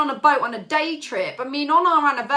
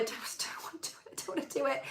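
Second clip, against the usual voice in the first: breathless whispered dread, no voice; 2 semitones higher, 14 dB quieter, faster than usual. Retold or imitated. Whispering to herself about not wanting to go on the boat. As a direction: whisper it like a secret you can't bear. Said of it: "And I was like".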